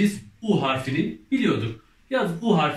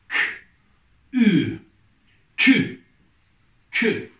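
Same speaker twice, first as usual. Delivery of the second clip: loud, drawn-out syllable reading for the class; reading syllables aloud; 6 semitones higher, 6 dB louder, slower than usual. Shown as a demonstration. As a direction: sound out each letter clearly, then blend them loudly. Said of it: "Okuyalım".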